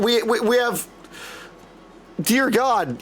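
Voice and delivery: ordinary delivery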